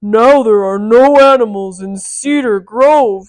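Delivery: booming loud giant with a falling end. Shown as a demonstration. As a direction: boom it out like a menacing giant. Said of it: "let me change that".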